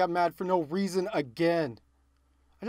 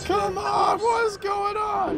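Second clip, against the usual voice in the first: frustrated yelling; 13 semitones higher than usual. Real, not acted. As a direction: shout at the screen in disbelief. Frustrated gamer yelling at game.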